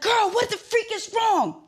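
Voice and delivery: retold panicked demand at a silent friend; frantic demand. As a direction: stretch it out in high panic. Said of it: "I'm like".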